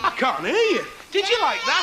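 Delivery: put-on high, loud call to a crowd of kids; rousing shout. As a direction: shout it to whip up a bigger answer. Pretends not to hear children.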